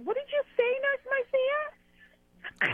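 very high, very slow mocking voice; mock American. Caller mimics Americans confused by her patois, very high and slow. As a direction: stretch it out, squeaky and puzzled. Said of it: "And they were like".

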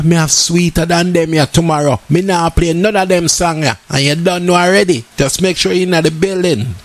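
ordinary delivery

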